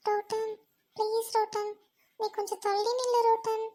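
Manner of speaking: downcast, barely inflected begging; sorrowful plea. Acted. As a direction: plead wearily for space. Tearful girl begs friend to step back.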